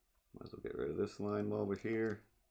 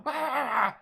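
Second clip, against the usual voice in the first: very high, loud cry mimicking a person; imitated human yell; 11 dB louder than usual. Streamer mimicking a person heard in the distance, high yell. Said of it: "sounded like a person going".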